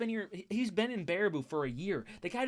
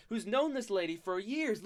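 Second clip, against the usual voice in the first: ordinary delivery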